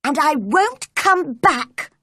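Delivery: high-pitched, defiant shout; angry shout. A narrator's reading. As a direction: shout a furious parting shot. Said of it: "Mary shouted in reply".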